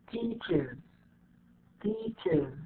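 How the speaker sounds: "teaches" ending in a separate iz; extra IZ syllable. Shown as a demonstration. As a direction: softly show "teaches" as teach-iz. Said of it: "you see how we pronounce it".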